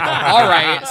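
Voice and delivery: laughing reply. Retold or imitated. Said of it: "And I'm like".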